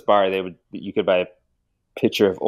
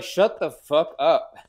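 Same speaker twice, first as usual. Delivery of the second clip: slow, high, hammed-up guard snap; mock gruff order through a door; mock angry guard; 7 semitones higher, 3 dB louder, slower than usual. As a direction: bark it like an annoyed jailer.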